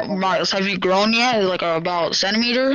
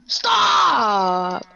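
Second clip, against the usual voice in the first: raging gamer; 2 semitones higher than usual. Real, not acted. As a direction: scream at the game in frustration. Losing the game, team dead.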